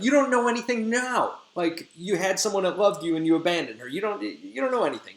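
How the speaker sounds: high-pitched mock indignation; indignant rebuff. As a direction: tell off a faithless suitor. Helena rebuffs Lysander, acted quarrel.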